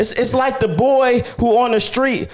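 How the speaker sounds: high, ringing preacher's voice; preaching at full force. Acted. Pastor preaches to listeners, high fervent preaching voice. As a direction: preach it out to the congregation.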